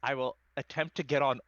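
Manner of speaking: ordinary delivery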